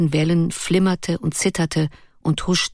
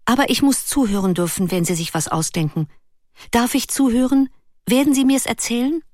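put-on higher, begging child's voice; pleading; 6 semitones higher than usual. Ruth begs Erik to let her listen, pleading. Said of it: "fügte dann bittend hinzu".

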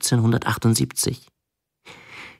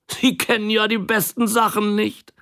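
pitying; 11 semitones higher than usual. A narrator's reading. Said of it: "sagte er mitleidig".

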